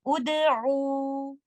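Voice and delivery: Ud'u recited slowly with a dhamma on the opening hamza; Quranic recitation. Shown as a demonstration. Teacher demonstrates Ud'u for her students, in a recitation voice. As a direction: draw out Ud'u, starting it with u. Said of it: "You will recite it like this".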